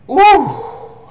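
high, loud ooh of surprise; a sudden delighted ooh; surprised ooh. Real, not acted. Opening the bag, smelling.

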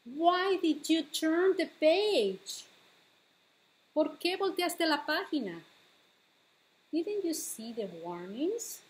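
usual speaking voice

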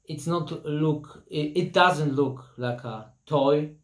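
ordinary talk, nothing marked

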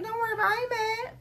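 high-pitched mock reassurance. Customer assuring the salon worker, high mock-sweet reassurance. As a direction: brush it off in a high, put-on voice.

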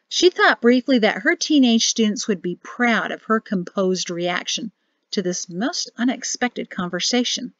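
usual speaking voice

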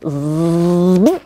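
buzzing like a mosquito. Retold or imitated. Narrator imitates a mosquito for the children, buzzing. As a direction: buzz out a mosquito hum.

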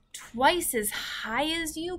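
put-on high, slow amazed girl; astonished. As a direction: gasp it out in disbelief. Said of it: "cried Sophie".

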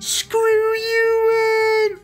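very high, crying mock-girl shriek; hammed-up teary breakup wail; mock teen girl outburst. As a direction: squeal it like a hurt teenage girl. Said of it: "as if he was a teenage girl".

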